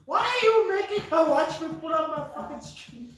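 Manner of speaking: loud. Real, not acted. Brother complains loudly.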